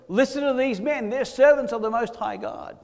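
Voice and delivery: high, loud public proclamation; acted town-crier shout, pitched well up; top of her voice. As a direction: call it out to everyone, imitating the slave girl. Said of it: "at the top of her voice".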